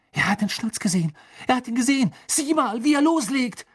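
high, urgent cries rising to a peak; excited shouting. A narrator's reading. Fan spots the seeker diving, excited shouting. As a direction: yell it out as the chase takes off.

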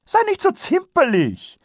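high, bright-toned noblewoman voice; brisk and jolly, dismissing the fuss; cheerful lady. Acted. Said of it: "rief Lady Käsedick fröhlich".

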